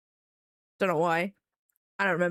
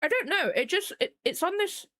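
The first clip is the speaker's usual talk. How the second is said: talking around a mouthful of ice; 9 semitones higher than usual. Real, not acted. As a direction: mouth full of ice, words muffled. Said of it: "Sorry, I got ice in my mouth".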